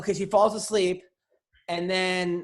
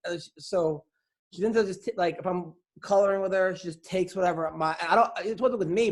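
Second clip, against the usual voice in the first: ordinary talk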